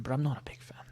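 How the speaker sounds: soft unvoiced whisper, half to yourself; sneaky whispered admission; whispered aside. Real, not acted. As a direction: confide it under your breath. Whispered contradiction after praise.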